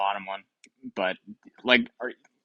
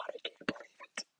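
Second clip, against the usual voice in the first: soft, airy whispered disbelief; stunned whisper; 16 dB quieter, much faster than usual. Real, not acted. Gamer whispering to himself in disbelief. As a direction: whisper it like you can't breathe.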